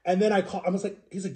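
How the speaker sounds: ordinary talk, nothing marked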